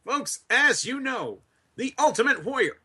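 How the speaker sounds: high, hammed-up wrestling announcer voice; mock promo. To his stream partner, re-doing the Ultimate Warrior promo bit in a high hammed-up voice.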